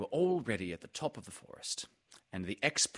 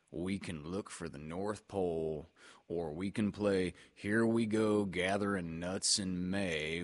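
gloomy, resigned donkey; Eeyore. Acted. Eeyore tells everybody it's all the same, gloomy.

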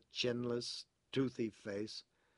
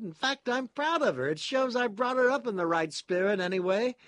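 proud father in a high, loud voice; high, emphatic, ruefully proud character voice; doleful pride; 10 semitones higher, 8 dB louder than usual. A narrator's reading. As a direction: boast with a plaintive edge. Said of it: "with a sort of doleful pride".

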